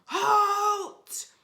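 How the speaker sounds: loud booming robber rat; deafening bellow. Acted. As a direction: bellow it to stop them dead. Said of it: "bellowed a deafening".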